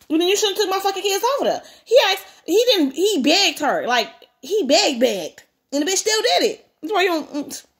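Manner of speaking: high, animated exasperated rant; indignant outburst. Real, not acted. Reader scolding mother for taking kids over there, indignant. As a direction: scold the character in disbelief. Reader's heated aside about the story.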